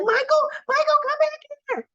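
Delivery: high, far-off calling out; calling after him. Retold or imitated. Olivia calling after Michael.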